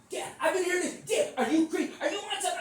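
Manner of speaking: high, loud mock yell; imitating a daughter's shrieked accusation; outraged daughter. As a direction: holler at Dad in disbelief. Acting daughter confronting her dad.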